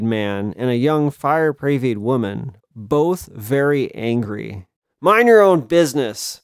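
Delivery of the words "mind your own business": loud curt snap; snapping. Acted. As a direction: bite the words off. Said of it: "snapped the woman".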